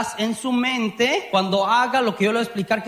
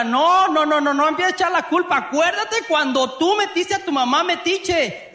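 defensive retort; 6 semitones higher than usual. Acted. Spouse snapping back at partner in a mock marital fight. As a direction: fire back fast to dodge the blame. Acted couple quarrel, defensive reply.

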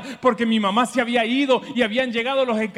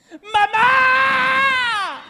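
put-on a frightened child's high wailing cry of "¡Mamá!", much higher; 13 semitones higher, 4 dB louder than usual. Preacher imitating a child calling his mother, high crying shout. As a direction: wail "¡Mamá!" like a scared little boy. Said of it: "¿qué dice uno?".

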